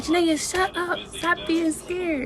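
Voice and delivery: high, mocking, slow "stop being scared"; voicing the relatives laughing at her; teasing family.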